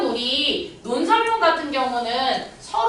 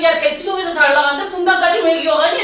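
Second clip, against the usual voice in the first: ranting; 5 semitones higher, 7 dB louder than usual. Retold or imitated. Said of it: "내 지랄하고 앉아있는데".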